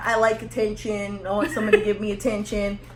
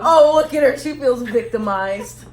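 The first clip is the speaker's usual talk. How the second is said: mocking; 3 semitones higher, 8 dB louder than usual. Real, not acted. Mocking partner's complaint.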